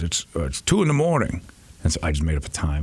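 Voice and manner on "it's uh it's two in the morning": higher, grumpy Scottish brogue; sleepy grumbling Connery; Sean Connery impression. Connery woken at night, Scottish grumble. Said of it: "And he goes".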